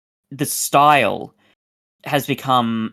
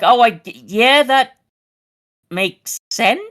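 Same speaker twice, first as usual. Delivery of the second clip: mock realization; 11 semitones higher, 5 dB louder, slower than usual. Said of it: "it's like".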